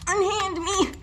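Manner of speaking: high, hammed-up melodrama cry; mock dramatic. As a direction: play the damsel in distress.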